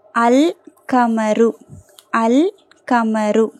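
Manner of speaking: very slow "அல் கமரு", L held clearly; teacher's slow model of "அல் கமரு", every part separate; lam pronounced. Shown as a demonstration. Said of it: "லாம் உச்சரிக்கப்படுது".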